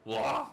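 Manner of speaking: sudden loud startled cry; startled yelp. Acted. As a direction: yelp in shock at a near crash. Near collision with pedestrian.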